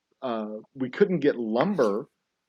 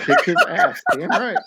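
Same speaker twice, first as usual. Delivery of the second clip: high, loud laughing cheer; laughing hype; 6 dB louder than usual. Real, not acted. Laughing and cheering as a friend jokes about the fight. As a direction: cackle out the cheer, fired up.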